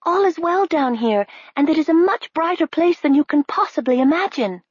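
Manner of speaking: put-on high, bright girl's voice; high girlish princess voice. A narrator's reading. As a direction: reassure a friend from below. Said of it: "she cried".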